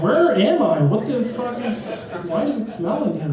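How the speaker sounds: high-pitched, baffled complaint; indignant newborn. Acted. Newborn just arrived in New Jersey, baffled protest.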